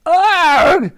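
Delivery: high, loud "argh"; yell of pain. A narrator's reading. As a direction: cry out as if whacked from behind. Narrator voices the struck professor.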